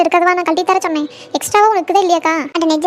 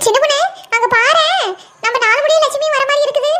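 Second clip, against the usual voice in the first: high-pitched, eager pointing out; higher, animated call to look; excited sighting; 7 semitones higher than usual. Acted. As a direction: alert a friend to someone coming, pitched up. Spotting a neighbour approaching.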